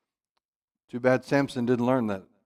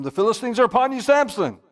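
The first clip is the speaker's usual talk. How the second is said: raised urgent call, higher and louder; 9 semitones higher, 4 dB louder than usual. Acted. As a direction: call out the alarm. Delilah calling out warning.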